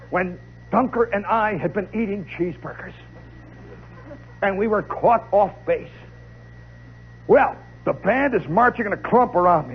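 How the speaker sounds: normal talking voice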